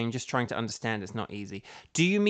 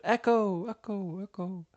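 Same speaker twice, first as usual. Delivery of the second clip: each Echo softer, fading out; 5 semitones higher, 3 dB quieter than usual. Pretend. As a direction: mimic an echo dying away. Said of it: "is it really echoey in here".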